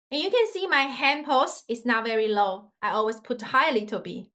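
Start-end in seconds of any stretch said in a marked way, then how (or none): none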